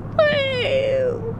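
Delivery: high whooping cheer; high woo cheer. Retold or imitated. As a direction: whoop like a partying college kid. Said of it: "driving home with a friend who like".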